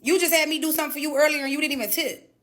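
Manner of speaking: loud, fast angry retort. Retold or imitated. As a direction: tell him off hard. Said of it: "I'm like".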